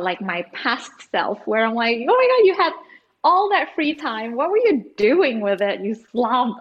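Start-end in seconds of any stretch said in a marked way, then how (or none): 2.0-6.6 hammed-up outrage at yourself; mock anger. Scolding her past self for wasted time, mock anger. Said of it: "I get really angry when I think".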